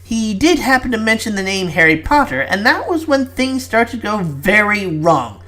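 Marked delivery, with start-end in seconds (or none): none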